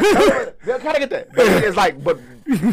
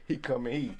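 ordinary talk

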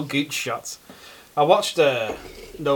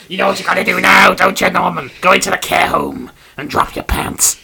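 hammed-up taunting goblin; 4 semitones higher, 8 dB louder than usual. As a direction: egg Norman on as the goblin. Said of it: "goblin voice continues".

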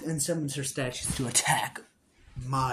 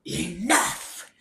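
witch shouting; 3 semitones higher, 4 dB quieter than usual. Acted. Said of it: "she bouts out".

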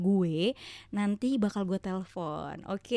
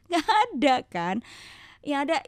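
ordinary talk, nothing marked

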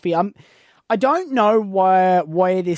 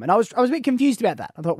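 ordinary delivery

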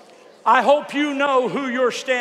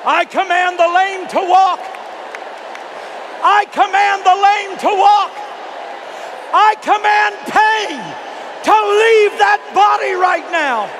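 preaching shout; 6 semitones higher, 3 dB louder than usual. Real, not acted. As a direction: command it with full force. Healing command.

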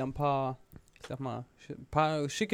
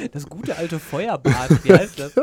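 laughing; 12 dB louder, slower than usual. Real, not acted. Joke about wrong word, laughing.

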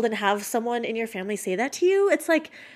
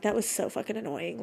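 much softer, muttered to herself; muttering after hanging up; 10 dB quieter than usual. Retold or imitated. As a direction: grumble it under the breath. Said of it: "I was like".

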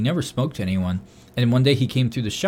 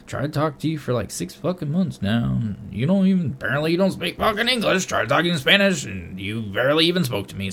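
higher-pitched, exasperated self-talk; talking to myself; 4 semitones higher than usual. Retold or imitated. Telling a silent coworker off while talking to himself, exasperated. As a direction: grumble out loud as if to him. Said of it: "I'm talking to myself out loud".

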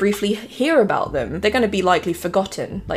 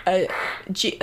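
normal talking voice

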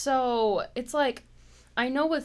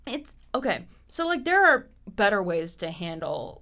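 ordinary delivery

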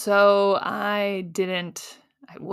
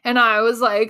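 ordinary talk, nothing marked

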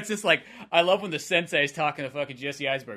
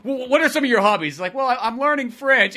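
mock chat; 8 semitones higher, 4 dB louder than usual. Retold sensei questioning, high.

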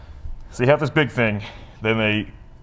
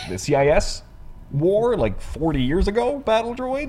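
incredulous; 9 semitones higher than usual. Real, not acted. Incredulous about the old battle droids.

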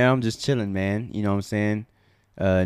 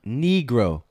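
ordinary talk

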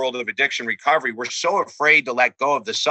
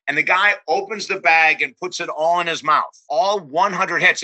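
ordinary delivery

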